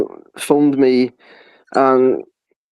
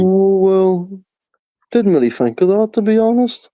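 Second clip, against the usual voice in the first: mocking hotel clerk; 7 semitones higher than usual. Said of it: "I was like".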